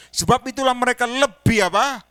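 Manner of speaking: a loud, high question thrown at the crowd; loud prompt. Real, not acted. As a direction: drive the congregation to answer. Preacher prompting the answer. (bertanya keras dan tinggi kepada jemaat)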